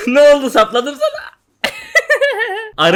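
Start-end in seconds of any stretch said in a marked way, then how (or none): 1.6-2.8 squealing short laugh; high giggle. Real, not acted. Laughing after landing hit.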